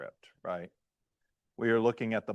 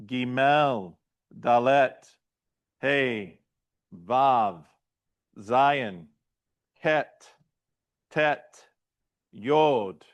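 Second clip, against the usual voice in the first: letter drill; much slower than usual. Shown as a demonstration. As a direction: call out each Hebrew letter for the class to repeat. Let's say this again.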